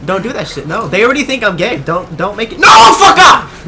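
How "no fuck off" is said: loud, slow yell, dragged out; furious shout. Real, not acted. Gamer yelling at an enemy player, furious slow shout. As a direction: scream it at him to back off. Panicked at attacker in game.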